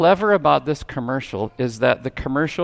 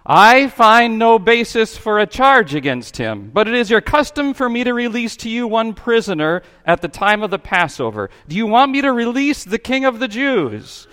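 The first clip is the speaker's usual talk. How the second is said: raised, higher governor addressing a crowd; Pilate addresses the crowd; 8 semitones higher, 5 dB louder than usual. A narrator's reading. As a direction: call it out over the crowd, high and loud. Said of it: "and said".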